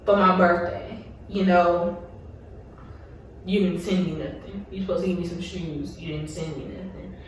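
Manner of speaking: quiet, choked-up voice, fighting tears; fading to almost nothing as the tears come; real hurt, voice dropping away on missing birthday gifts; holding back tears. Real, not acted. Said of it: "I'm trying not to get emotional".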